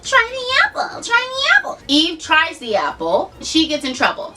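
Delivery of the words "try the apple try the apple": high, wheedling snake voice; tempting serpent. Retold or imitated. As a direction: coax her like a sly serpent. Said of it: "The serpent's like".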